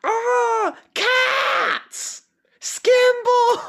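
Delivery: very high, panicked mouse shriek; squeaky mouse cry. Acted. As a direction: cry out as a tiny mouse in falsetto. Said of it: "the mouse shows up and goes".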